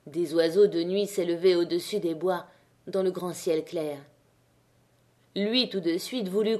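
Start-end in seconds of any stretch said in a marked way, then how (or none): none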